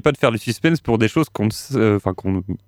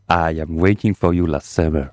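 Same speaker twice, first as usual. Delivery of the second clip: languorous voice; 5 semitones lower than usual. Acted. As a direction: croon the title like a seducer. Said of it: "Dit-il d'une voix langoureuse".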